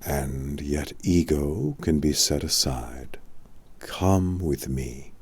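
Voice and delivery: put-on tender reassuring old man; softened voice. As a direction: soothe the troubled boy. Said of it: "Merlin softened his voice".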